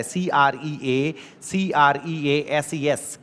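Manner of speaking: letters drawn out very slowly; slow spelling. Shown as a demonstration. Teacher spelling creases slowly for the class. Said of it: "सलवटों को creases कहा जाता है".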